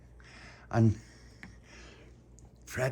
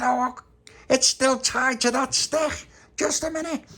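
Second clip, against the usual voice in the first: high, louder, slow and patient; Tony reassuring the others, high-pitched; high character voice; 5 dB louder, slower than usual. Acted. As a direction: voice Tony fixing the boat.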